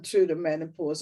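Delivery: usual speaking voice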